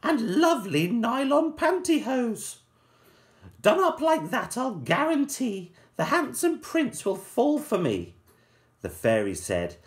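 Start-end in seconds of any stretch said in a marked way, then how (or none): 0.0-8.2 high, put-on voice of a vain ugly sister; high mock-posh sister voice. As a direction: preen like a silly sister sure of the prince. Ugly sister boasting in story.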